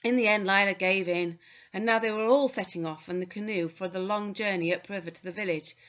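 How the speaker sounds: ordinary delivery, nothing marked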